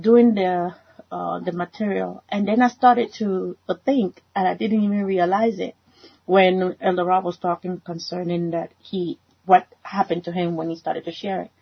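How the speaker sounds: ordinary delivery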